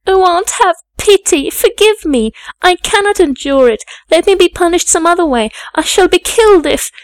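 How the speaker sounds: very high, loud, anguished begging; panicked child's outcry; frantic pleading. A narrator's reading. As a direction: beg desperately for mercy. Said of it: "my now frantic anguish and wild sobs".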